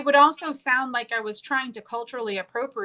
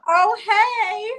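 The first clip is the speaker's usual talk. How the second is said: mock greeting; 11 semitones higher, 6 dB louder than usual. Playful over-the-top girly greeting.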